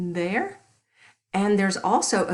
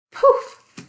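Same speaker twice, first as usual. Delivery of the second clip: breathy whispered magic poof; 8 dB louder than usual. Acted. Magic transformation in children's story.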